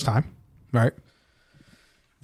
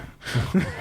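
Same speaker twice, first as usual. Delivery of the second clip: breathy laugh; 4 semitones higher, 5 dB quieter than usual. Real, not acted. Laughing at his own joke.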